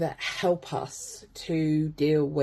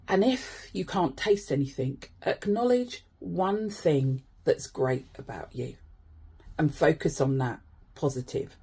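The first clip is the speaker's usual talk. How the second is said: calm grounding exercise; 3 semitones lower, 5 dB quieter than usual. Acted. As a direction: talk them gently through each sense.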